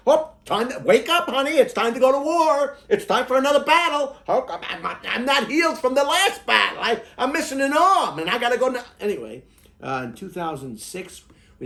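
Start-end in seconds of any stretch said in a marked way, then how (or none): 0.1-8.8 high falsetto wake-up call; sing-song high voice rousing a sleeper; gently waking a child. Pretend. Voices a spouse waking him.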